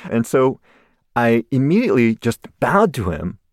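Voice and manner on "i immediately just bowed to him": ordinary talk, nothing marked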